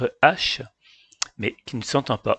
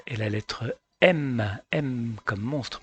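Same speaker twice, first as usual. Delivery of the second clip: ordinary talk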